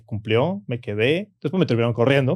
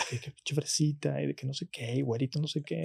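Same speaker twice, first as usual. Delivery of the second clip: burla imitada; 3 semitones higher, 11 dB quieter than usual. Retold or imitated. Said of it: "me empezaron a decir groserías".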